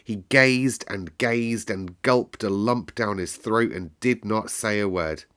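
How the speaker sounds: usual speaking voice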